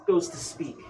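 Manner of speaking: ordinary talk, nothing marked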